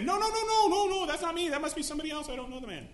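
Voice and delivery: very high, loud panic fading down; frantic denial. Retold or imitated. Peter denying he knows Jesus, very high frantic then fading.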